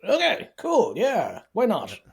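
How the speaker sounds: high, swooping sarcastic acceptance; mock agreement. Cohost mocks the film's move to New York, mock agreement. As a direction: go along with it in a high, sceptical singsong.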